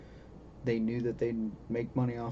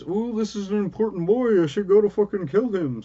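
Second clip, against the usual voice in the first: higher, louder mock villain voice; mocking Reva voice; 9 semitones higher, 8 dB louder than usual. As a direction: sneer it out as a dumb cartoon villain. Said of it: "It says".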